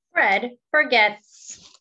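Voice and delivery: Fred Forgets spoken slowly and proudly like a show title; slow title announcement. Acted. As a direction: stretch each word of Fred Forgets as a grand title.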